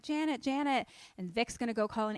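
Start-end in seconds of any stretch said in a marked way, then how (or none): 0.0-0.9 urgent calling. Retold or imitated. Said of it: "they're like".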